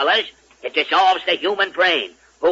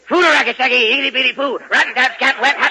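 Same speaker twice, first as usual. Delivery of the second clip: higher, sing-song nonsense babble; dazed Bungo spouting swing nonsense; dopey swing gibberish; 8 semitones higher than usual. Acted. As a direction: babble like a drugged-up scat lyricist. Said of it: "Bungo can write our swing lyrics".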